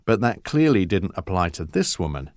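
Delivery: usual speaking voice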